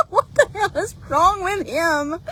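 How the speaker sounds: laughing. Real, not acted. Cracking up at the horse.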